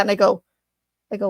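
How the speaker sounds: usual speaking voice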